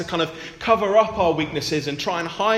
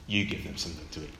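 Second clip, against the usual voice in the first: lower, quick, calm command; a lightly voiced Jesus setting the task; Jesus' command; 11 semitones lower, 8 dB quieter, faster than usual. Jesus telling the disciples to feed the crowd, lower and firm. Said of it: "See what he says to them?".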